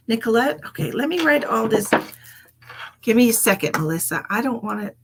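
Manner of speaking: usual speaking voice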